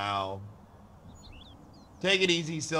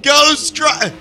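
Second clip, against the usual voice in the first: shouting; 9 semitones higher, 7 dB louder, much slower than usual. Real, not acted. Excited exclamation.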